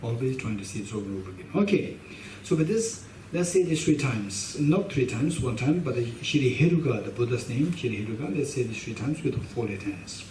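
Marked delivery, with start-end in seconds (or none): none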